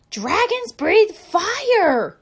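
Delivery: slow, very high, loud yell; coach yelling. Acted. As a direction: bellow it at the class. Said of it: "yelled the coach".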